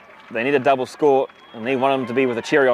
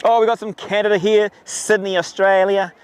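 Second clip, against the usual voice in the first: put-on higher, playful Australian accent; light Australian accent, shouting out fans; Australian twang; 6 semitones higher than usual. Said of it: "I even put the Australian twang in there".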